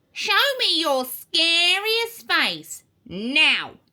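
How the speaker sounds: slow, drawn-out witchy order; commanding witch teacher. A narrator's reading. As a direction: order the pupil about like a witch.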